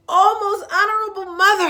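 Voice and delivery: high, loud breathless calling; panting shout. Acted. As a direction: call out while gasping for breath. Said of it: "he panted".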